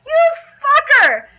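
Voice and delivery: outraged at the villain; indignant curse. Real, not acted. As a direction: spit the insult out loudly. Cursing the villain of the story.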